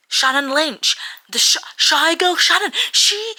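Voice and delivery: high, loud incredulous squeal; shocked squeal. Real, not acted. Fan shrieking about Shy Girl Shannon. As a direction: shriek her name in disbelief.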